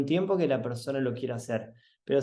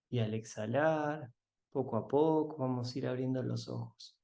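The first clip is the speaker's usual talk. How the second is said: guided meditation; 5 dB quieter than usual. Acted. Guiding listeners to open their eyes, soft meditation voice. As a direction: ease them gently out of the meditation.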